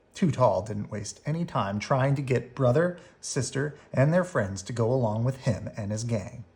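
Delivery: ordinary delivery, nothing marked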